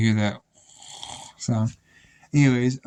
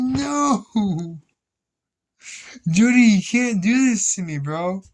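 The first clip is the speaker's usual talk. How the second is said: cringing plea; 6 semitones higher, slower than usual. Retold or imitated. Begging Jody to stop singing.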